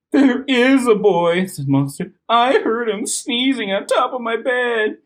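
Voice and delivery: little monster voice. Acted. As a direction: squeak out the alarm. Monster child reports.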